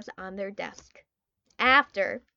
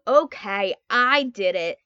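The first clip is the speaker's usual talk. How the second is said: loud confession; 2 semitones lower, 7 dB louder than usual. Acted. Boy confessing under pressure.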